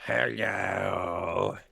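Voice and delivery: slow creaky drawn-out hello; scarecrow voice. Acted. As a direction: voice a creepy scarecrow. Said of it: "That's a good scarecrow voice".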